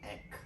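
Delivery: "ek" said as a short e then a crisp k; sound ek. Shown as a demonstration. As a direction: say "ek" briefly, with the k clipped. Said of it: "les prononce comme".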